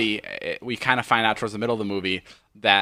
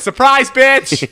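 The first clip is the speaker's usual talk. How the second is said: slow, much higher and louder call; mock yell; 13 semitones higher, 9 dB louder, much slower than usual. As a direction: yell it out of the car window. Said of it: "I said".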